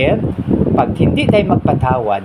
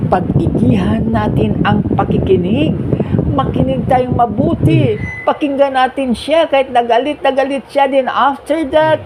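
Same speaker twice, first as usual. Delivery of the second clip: fervent urging; 10 semitones higher than usual. Real, not acted. Urging the congregation to listen.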